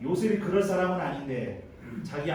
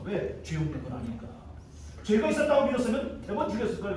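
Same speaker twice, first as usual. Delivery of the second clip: ordinary talk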